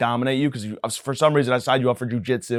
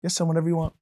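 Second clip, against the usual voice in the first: cowering; 5 semitones higher, 3 dB quieter, much faster than usual. Retold or imitated. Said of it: "I, like, cower".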